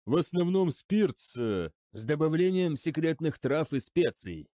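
ordinary delivery

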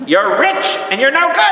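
high, loud heckle at a ballplayer; imitated mother yelling. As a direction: yell it like an outraged mom in the stands. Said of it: "she yelled at Alex Rodriguez".

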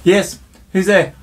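high, loud door-answering call. Pretend. Host calls to visitors at the door, calling out. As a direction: sing out slowly to whoever is knocking.

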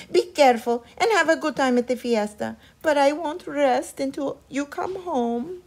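high, loud anxious farewell; fretful mother. A narrator's reading. Mother sends her sons off, high anxious voice. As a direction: send them off with worry. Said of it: "their mother said".

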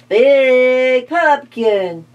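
slow, drawn-out mummy voice. Acted. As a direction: moan it slowly like a mummy. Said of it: "said the mummy".

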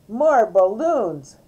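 loud, drawn-out bossy demand; bossy order. A narrator's reading. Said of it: "D W ordered".

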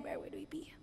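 hushed unvoiced whisper. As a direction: mutter it under breath. Martha mutters to herself.

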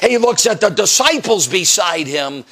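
ordinary delivery

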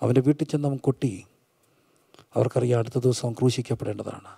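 low, soft, with long pauses; hushed preacher storytelling. Acted. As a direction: tell it gravely, almost in a whisper. Hushed dramatic storytelling in sermon.

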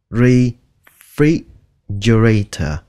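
refrigerator slowly, a gap after each chunk; syllable by syllable. Shown as a demonstration. Teacher drills learners, slow separated syllables. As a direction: sound out refrigerator piece by piece.

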